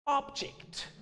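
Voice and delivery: British object, OB-ject said lightly, nearly breathed; British accent. Shown as a demonstration. Teacher showing how the British say object, in a British accent.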